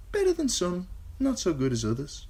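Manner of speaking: put-on high, casual young woman. A narrator's reading. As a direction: shrug it off.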